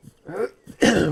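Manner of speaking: sharp, high throat clear; loud throat clearing. Real, not acted. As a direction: clear your throat loudly.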